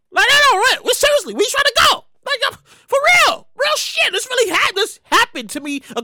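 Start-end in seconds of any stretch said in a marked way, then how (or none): 0.3-4.1 mock confrontation. Confronting man on the train.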